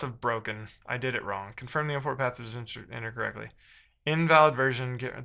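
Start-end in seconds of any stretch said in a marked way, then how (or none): none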